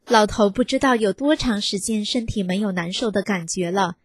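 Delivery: usual speaking voice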